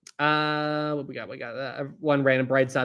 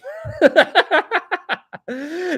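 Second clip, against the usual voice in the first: high laugh; 6 dB louder than usual. Real, not acted. Laughing at Brightside knowing him.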